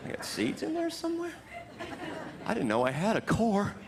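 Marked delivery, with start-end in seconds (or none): 0.1-1.3 mock high squeak. Joking about being an apple.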